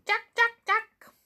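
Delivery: high, sharp bird calls in quick repeats; crying jackdaw. A narrator's reading. Jackdaw crying over the children's heads, high squawked call.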